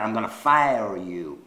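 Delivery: low, slow catchphrase impression; Donald Trump. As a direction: mimic the TV boss slowly. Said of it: "This is Donald Trump's favorite, uh, phrase".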